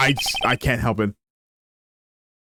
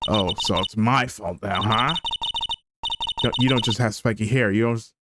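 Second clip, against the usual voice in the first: usual speaking voice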